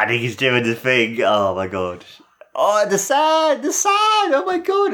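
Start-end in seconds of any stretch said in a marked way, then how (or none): 2.5-4.9 high-pitched delighted disbelief; real squeal of excitement; excited outburst. Real, not acted. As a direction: cry out high, thrilled by what you see. Reacting excitedly to sand moment.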